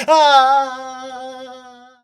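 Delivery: high loud terrified scream. Acted. Ed fleeing the water again, high loud scream. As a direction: shriek as you flee. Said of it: "ran away screaming".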